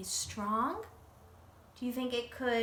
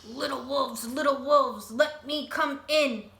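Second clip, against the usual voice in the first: loud, growling big bad pig; grunting pig; 3 semitones higher, 7 dB louder than usual. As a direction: call through the door with menace.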